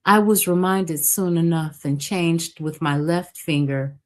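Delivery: usual speaking voice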